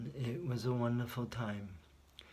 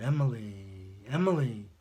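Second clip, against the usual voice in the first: retold shout, much louder than the telling; retold scream; 9 dB louder than usual. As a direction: cry the name out across a crash scene. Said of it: "So he screams out".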